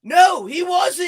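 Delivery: loud heated denial; shouting back. Real, not acted. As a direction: shout him down. Heated football argument.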